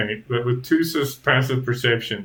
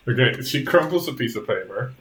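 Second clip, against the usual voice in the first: ordinary delivery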